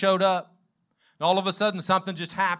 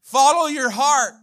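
higher, slow, cheering a buddy on; slow, heartfelt pep talk, pitched up; encouraging friend; 5 semitones higher, slower than usual. Retold or imitated. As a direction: lift him up like a loyal friend. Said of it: "We need somebody to say".

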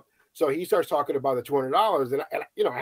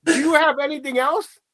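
impatient judge; 12 semitones higher, 6 dB louder, slower than usual. Retold or imitated. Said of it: "She's like".

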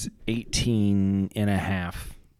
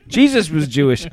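laughing; 6 semitones higher, 9 dB louder than usual. Real, not acted. Laughing through a joke.

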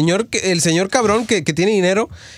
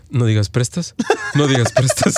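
laughing inner voice; 7 semitones lower than usual. Retold or imitated. Inner voice suppressing a pun.

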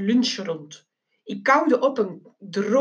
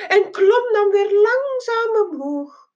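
climbing; 11 semitones higher than usual. A narrator's reading. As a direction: voice soars high with the climb. Voice climbs with the plane.

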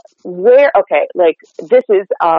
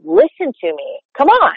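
"Come on" punched high and emphatic; American emphasis; 8 semitones higher than usual. Shown as a demonstration. Coach mimicking emphatic American delivery. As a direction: punch the words for importance. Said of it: "strong word emphasis and volume".

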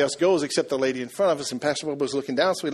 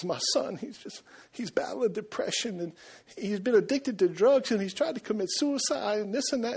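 higher, softer imitation of a weepy mom; mock tearful mother; 6 semitones higher, 5 dB quieter than usual. Mom telling the pastor about her troubled son, lightly imitated tearfulness. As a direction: lightly voice a mother starting to cry. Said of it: "and she starts crying".

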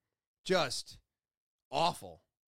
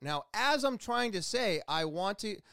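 ordinary delivery

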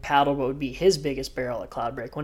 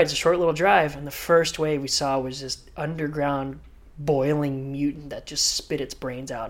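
ordinary talk

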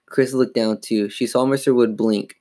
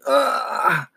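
drawn-out pained groan; throaty cry of pain; 13 semitones higher than usual. A narrator's reading. Dummy hitting the floor hard, throaty pained groan. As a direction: groan as if hurt. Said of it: "throaty cry of pain".

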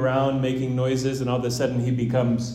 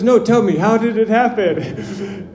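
laughing insistence; 9 semitones higher, 7 dB louder than usual. Retold or imitated. Lady presses, laughing and loud.

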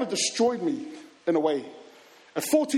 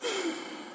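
sigh; 13 semitones higher, 7 dB quieter than usual. Retold or imitated. Heartbroken at the news.

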